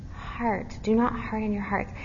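usual speaking voice

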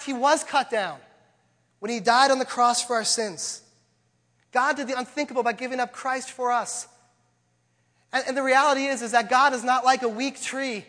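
preacher building to the climax of the sermon; preaching at full force. Acted. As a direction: drive the point home to the congregation.

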